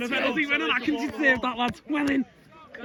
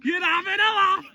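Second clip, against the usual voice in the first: much higher, shouted cheer; rowdy jeering pub banter; 11 semitones higher than usual. Real, not acted. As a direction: roar it back at your mate. Joins friend's cheer.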